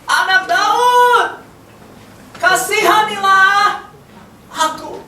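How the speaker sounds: high, long-drawn cry for mercy; pleading high call. Acted. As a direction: shout it slowly, stretching each name. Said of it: "Ia berseru apa?".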